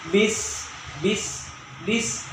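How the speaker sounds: bis with a strong airy s; hissing sin. Shown as a demonstration. Teacher drilling bis to show the airy s. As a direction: push the s of bis out hard with air. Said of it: "harus dikuatkan".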